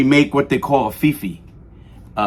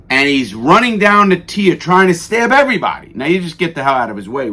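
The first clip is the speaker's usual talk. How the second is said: ordinary talk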